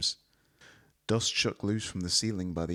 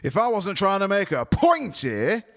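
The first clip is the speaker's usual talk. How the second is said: much higher and louder, slow, near shout; rising further; 13 semitones higher, 9 dB louder than usual. A narrator's reading. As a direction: push the anger higher still. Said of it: "his voice rising further".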